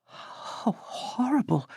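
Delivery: shocked gasp. A narrator's reading. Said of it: "Lofton gasped".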